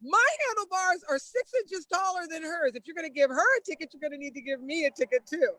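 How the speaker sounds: high-pitched imitated protest; indignant challenge. Friend challenges officer over her pal's handlebar ticket, indignant. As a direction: argue back as the indignant friend.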